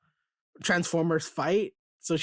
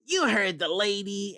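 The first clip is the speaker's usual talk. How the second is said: put-on goofy hero voice, slightly raised; dumb voice; 4 semitones higher than usual. Host voices Cliffjumper arriving to help, dumb voice. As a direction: do a silly robot hero entrance. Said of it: "I give him a dumb voice".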